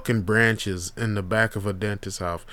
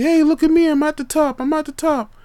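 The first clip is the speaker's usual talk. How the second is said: much higher and louder, showing off; bragging kid; 6 dB louder than usual. Retold or imitated. Khalil at the tree top calling down, excited. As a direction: call down proudly from a treetop. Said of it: "He's like".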